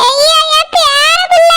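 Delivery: high, slow lament; tearful wail. Acted. Grandmother longing for her grandson, wailing. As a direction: wail it out, drawing the words long. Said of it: "நீங்க அழாதங்கம்மா".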